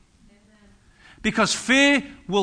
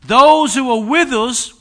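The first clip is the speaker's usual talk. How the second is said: proclaiming prophet; 5 semitones higher, 9 dB louder, slower than usual. Retold or imitated. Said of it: "the prophet answered".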